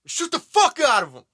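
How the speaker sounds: high, loud repeated threat; loud tough threat. Retold or imitated. Viewer voicing the hero's threat, high and loud. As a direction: punch it out like a battle cry. Voicing what hero should say.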